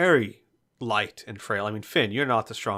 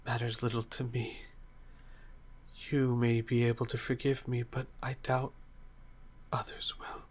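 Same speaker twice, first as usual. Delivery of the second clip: soft, frail voice fading to whispers; halting, defeated near-whisper; weak resignation; 8 dB quieter than usual. Acted. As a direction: speak faintly, accepting your fate. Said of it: "nods slowly and then says".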